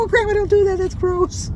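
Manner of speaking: put-on high, loud child voice; grandkids imitated. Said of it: "They go".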